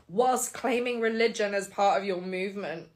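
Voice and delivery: ordinary talk, nothing marked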